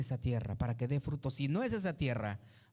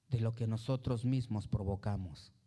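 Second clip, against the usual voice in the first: normal talking voice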